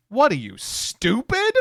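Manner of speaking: high, slow, disbelieving jab; mock incredulous. Friends mocking Leah's refusal to run, incredulous. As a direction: scoff at her like she's lost it. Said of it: "we were like".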